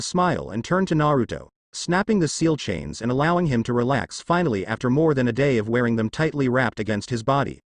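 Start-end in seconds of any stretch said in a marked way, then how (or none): none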